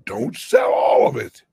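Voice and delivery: loud, high burst of warning; shouted caution to silver holders; urgent shout. Real, not acted. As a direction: cut in with a sharp loud warning. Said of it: "you've never heard me talk like this".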